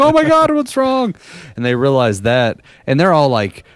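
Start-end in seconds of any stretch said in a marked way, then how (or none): 0.1-1.1 alarmed relatives. Retold or imitated. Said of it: "everyone was like".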